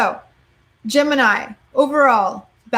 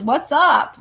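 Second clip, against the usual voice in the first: ordinary talk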